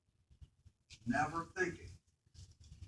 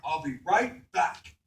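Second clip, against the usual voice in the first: loud, drawn-out call to the family; 3 semitones higher, 12 dB louder, much slower than usual. Retold or imitated. Father calling to family at the camper, loud slow call-out. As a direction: call it across the yard, slowly.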